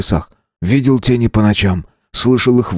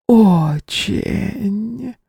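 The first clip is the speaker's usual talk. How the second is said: drawn-out seductive word; 13 semitones higher than usual. A narrator's reading. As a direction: stretch it out suggestively. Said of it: "Последнее слово Джорио протянула с такой интонацией". (протяжно и соблазнительно)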